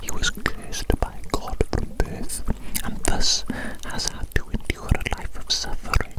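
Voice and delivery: normal talking voice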